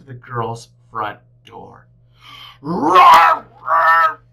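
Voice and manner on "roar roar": very high loud playful roar; bear roaring. A narrator's reading. Bear stomping down the mountain, roaring loudly.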